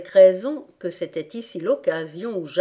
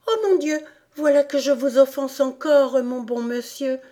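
high-pitched, sorry exclamation; dismayed; 7 semitones higher than usual. A narrator's reading. Alice realises she has offended the rat again, dismayed. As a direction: exclaim in dismay at your own blunder. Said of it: "d'une voix désolée".

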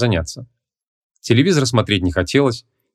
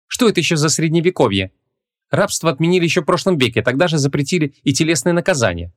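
put-on higher-pitched young woman objecting; higher voice, indignant objection; Ванесса, indignant heroine; 7 semitones higher than usual. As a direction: protest in a raised female character voice. Indignant heroine's dialogue in story.

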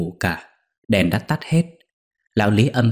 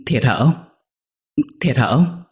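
tearful asking; 5 semitones higher than usual. Acted. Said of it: "chợt tỉnh khóc rồi nói".

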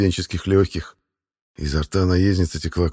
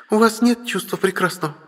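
giggling; 14 semitones higher than usual. Acted. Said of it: "тоже хихикнула девушка".

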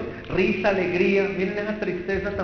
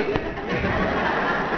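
laughter. Real, not acted. Audience laugh.

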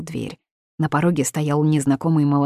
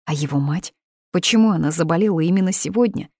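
plain reading voice

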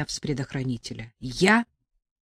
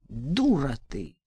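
spat contempt; 3 semitones lower, 3 dB quieter, slower than usual. A narrator's reading. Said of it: "сплюнул Жорик".

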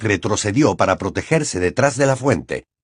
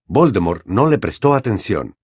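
ordinary delivery

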